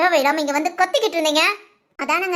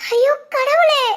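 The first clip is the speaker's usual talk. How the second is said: higher, louder cry of dismay; shocked cry; 7 semitones higher, 4 dB louder, slower than usual. Acted. Mother cries out in shock at the insults.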